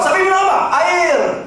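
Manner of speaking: high shouted question and answer; high quiz shout. Acted. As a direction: call it out to the crowd. Second round quiz shouted.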